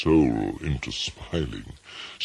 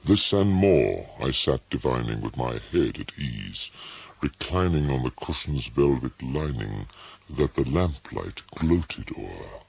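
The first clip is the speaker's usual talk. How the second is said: plain reading voice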